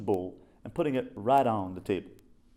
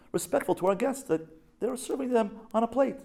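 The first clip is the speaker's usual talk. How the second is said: pompous lecturing husband; 12 semitones higher than usual. Retold or imitated. Husband lecturing in imagined argument.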